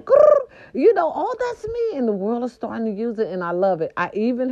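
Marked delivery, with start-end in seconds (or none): 0.0-0.5 high, loud growl; playful growl. Pretend. Host bragging about her lingo, a loud growl. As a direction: let out a big playful growl.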